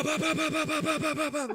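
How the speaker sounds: high, rattling run of gun noises; imitated machine-gun fire. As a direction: mimic a machine gun emptying its magazine. Imitating the Spitfire firing.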